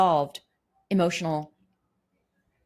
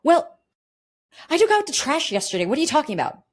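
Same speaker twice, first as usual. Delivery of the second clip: high, loud indignant comeback; hurt partner firing back, much higher and louder; defensive retort; 10 semitones higher, 6 dB louder than usual. Retold or imitated. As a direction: snap back, stung and defensive. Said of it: "And you're like".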